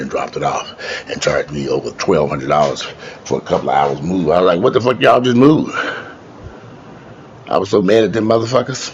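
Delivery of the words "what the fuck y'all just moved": outraged. Retold or imitated. Movers charged twelve hundred.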